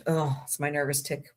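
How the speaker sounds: ordinary delivery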